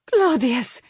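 high, loud cry of astonishment; gasped. A narrator's reading. Mother seeing her long-lost son, gasping his name. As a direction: gasp out the name, pitched well up. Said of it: "she gasped".

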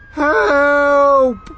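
long, high, loud scream for help; panicked cry. A narrator's reading. Centipede cries out as the peach plummets, panicked scream. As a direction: cry out in terror. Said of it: "cried the centipede".

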